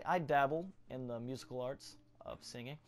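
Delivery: usual speaking voice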